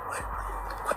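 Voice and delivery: very soft whispered question; barely voiced, puzzled whisper; whispered reaction. Travellers answering two strangers on the train, in a whisper.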